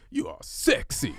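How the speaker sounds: booming high announcer yell; monster truck ad. Retold or imitated. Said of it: "like a monster truck announcer".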